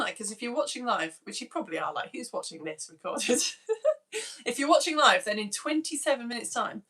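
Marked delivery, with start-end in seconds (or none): 3.5-4.4 squeaky little laugh; real amused laugh; a quick high chuckle; high giggle. Real, not acted. Laughs at own question.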